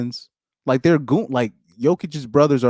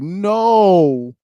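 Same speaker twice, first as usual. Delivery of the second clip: higher, louder horrified "No"; recalled alarm; 8 semitones higher, 6 dB louder than usual. Retold or imitated. Host recalling hearing the clip, with a louder horrified no. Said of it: "'Cause I was like".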